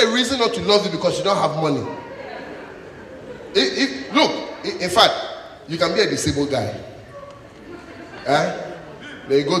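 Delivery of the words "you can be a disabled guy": ordinary delivery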